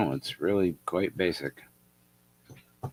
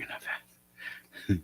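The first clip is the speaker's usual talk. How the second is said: whispering; 8 dB quieter, much slower than usual. Acted. Whispered hat aside.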